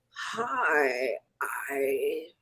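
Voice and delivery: breathy ghostly whisper, barely voiced; creepy whisper. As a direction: whisper it like a ghost calling her name. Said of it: "Then I hear this creepy voice".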